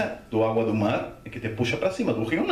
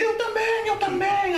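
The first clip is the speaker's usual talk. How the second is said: very high, comic begging; urgent plea. Retold or imitated. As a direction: act out begging not to be left behind. Begs lifeguard to save him too. (súplica cômica e urgente, muito aguda)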